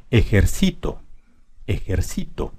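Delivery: ejercito stressed ejer-CI-to. Shown as a demonstration. Teacher moving the stress to sí, ejer-CI-to in ejercito. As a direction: put the weight of ejercito on CI. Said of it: "Vamos a ponerla en sí".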